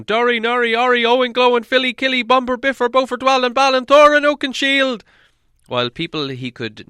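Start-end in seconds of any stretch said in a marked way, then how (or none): 0.0-5.0 high, loud frantic calling into the dark; a lost hobbit calling for his friends; calling out names. Acted. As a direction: shout the names, lost and desperate. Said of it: "calling, calling".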